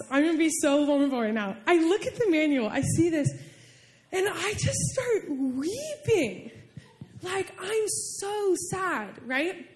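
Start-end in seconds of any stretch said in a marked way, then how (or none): 4.1-9.7 soft, high and slow, close to weeping; near tears. Real, not acted. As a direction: let the voice rise and thin toward tears. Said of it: "I'm almost crying right now".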